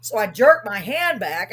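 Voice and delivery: ordinary talk, nothing marked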